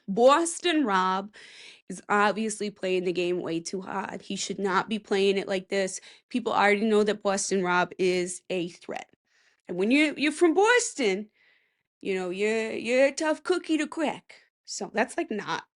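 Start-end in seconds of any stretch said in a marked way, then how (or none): 0.1-1.3 slow, drawn-out Boston accent on Boston Rob. Shown as a demonstration. Showing off a Boston accent on the name, Boston accent. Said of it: "a Boston accent". 9.7-14.4 tough-guy Boston accent. Shown as a demonstration. Describing Boston people, in a Boston accent. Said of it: "a Boston accent".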